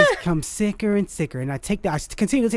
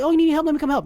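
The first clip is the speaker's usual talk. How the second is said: higher, sugary offer of help; pretending to care, a bright fake offer; fake eager helper; 7 semitones higher, much faster than usual. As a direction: sound overly eager to help. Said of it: "I was like".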